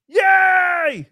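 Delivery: loud, high drawn-out cheer; shouted hooray, held high; mock cheer. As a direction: cheer out loud, stretching the word.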